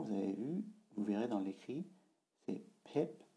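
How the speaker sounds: normal talking voice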